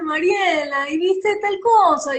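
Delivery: mild Guayaquil accent, teasing a friend; Guayaquil singsong. Shown as a demonstration. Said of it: "hablé así".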